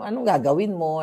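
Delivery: ordinary talk, nothing marked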